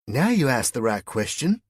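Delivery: put-on high, loud Southern US accent. A narrator's reading. West Virginian woman approves his question in a high Southern accent. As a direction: answer brightly pitched in a Southern accent. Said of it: "Your accent".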